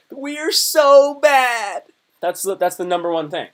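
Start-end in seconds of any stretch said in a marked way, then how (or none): 0.1-1.8 much higher and louder, very slow; gleeful shout. Retold or imitated. Teen crowing to his buddy in class, loud and drawn out. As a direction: crow it with glee.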